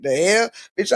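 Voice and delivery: usual speaking voice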